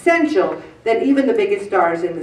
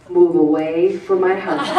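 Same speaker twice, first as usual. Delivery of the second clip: "Move away from my husband" through a laugh, lower; playful retold warning, laughing; laughing mock order; 4 semitones lower than usual. Retelling shooing a girl away.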